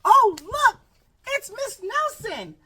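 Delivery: high, loud, slowed surprise at who enters; big storytime surprise, slowed down; surprised gasp. Acted. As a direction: squeal it out like you just spotted her. Reader gasps at the reveal.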